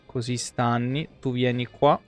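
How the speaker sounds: ordinary delivery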